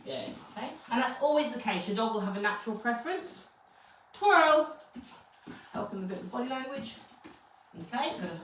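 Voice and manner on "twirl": high, loud one-word cue; sung-out command, pitched up; dog command. Real, not acted. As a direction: call out the trick cue to your dog. Calling a trained cue to dog.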